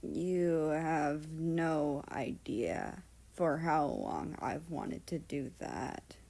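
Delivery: put-on slow, soft, slurred drunken words; slurring drunk. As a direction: slur it like a tipsy man. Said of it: "slurring a tiny bit".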